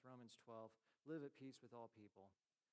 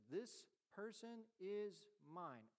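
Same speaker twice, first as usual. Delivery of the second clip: slow solemn declaration; 4 semitones higher, much slower than usual. Retold or imitated. Voicing God naming a child.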